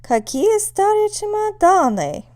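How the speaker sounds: loud, very high "Какие старые чемоданы", held up until the end; exclamation intonation. Shown as a demonstration. Host family seeing a bunch of old suitcases, loud exclamation. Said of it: "your host family might say".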